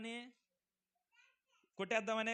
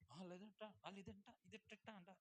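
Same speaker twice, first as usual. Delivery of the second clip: hushed gossiping murmur, much softer; 14 dB quieter than usual. Retold or imitated. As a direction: lean in and pass on the rumour softly. Gossip whispered house to house. (గుసగుసలాడే చాడీల స్వరం)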